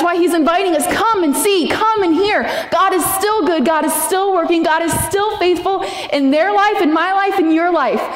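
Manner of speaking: high, ringing preacher's call; rally the congregation in praise; preaching at full force. Acted. Preaching climax, psalm invitation.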